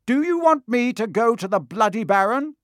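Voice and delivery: high, loud, indignant challenge; loud threat. A narrator's reading. Percy threatening Peeves with the Baron, loud threat. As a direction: shout it as a warning, pitched well up.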